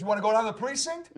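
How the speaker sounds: loud, high threat; yelling cop. Retold or imitated. Cop threatening the actor with arrest, yelling. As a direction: re-enact a cop's hollered warning. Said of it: "a cop across the street yelling at me".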